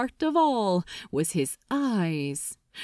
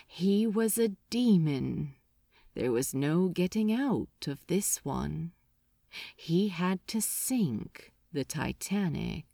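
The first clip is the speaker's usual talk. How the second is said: softer and lower, fading away; fading murmur; 4 semitones lower, 5 dB quieter than usual. A narrator's reading. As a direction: let it die into numbness. Repeated compulsion fading.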